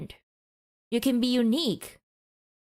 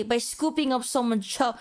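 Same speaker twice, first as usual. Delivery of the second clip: ordinary talk, nothing marked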